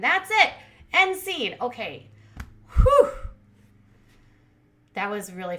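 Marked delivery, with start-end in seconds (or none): 0.0-1.6 high, loud finish; big wrap-up after a breathless recap; triumphant sign-off. Real, not acted. Triumphantly closing the rapid recap. 2.8-3.1 loud, high whoop of breath; exaggerated exhale after a long race; relieved whew. Real, not acted. Relief after finishing fast recap.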